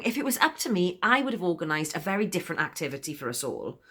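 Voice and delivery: ordinary delivery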